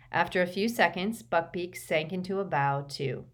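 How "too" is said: ordinary delivery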